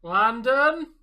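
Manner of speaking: loud, slow high shout. Real, not acted. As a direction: yell across the room for him. Calling out to friend for intro.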